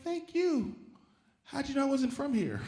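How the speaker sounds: high, mock-polite comeback; mock reply in high voice. Comic's imagined reply to the thanking woman. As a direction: play it innocent and high. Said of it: "It's like".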